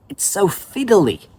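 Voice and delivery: ordinary delivery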